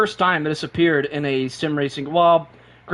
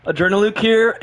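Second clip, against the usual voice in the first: normal talking voice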